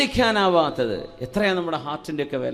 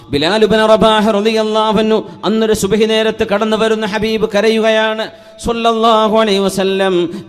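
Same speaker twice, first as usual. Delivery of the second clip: loud, high-pitched sermon delivery; impassioned preaching; 4 semitones higher, 9 dB louder than usual. Real, not acted. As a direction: preach it out in ringing, sustained phrases. Preaches a story of Bilal.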